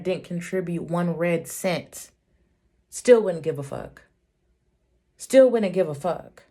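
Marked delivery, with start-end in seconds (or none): 2.9-4.0 defiant. Real, not acted. Repeated defiant outburst. 5.2-6.4 defiant. Real, not acted. Repeated defiant outburst.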